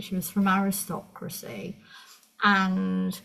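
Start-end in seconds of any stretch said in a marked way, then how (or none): none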